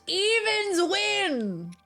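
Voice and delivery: slow, high, stretched call; drawn-out announcement. Real, not acted. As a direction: stretch it like a game host. Announcing the coin result.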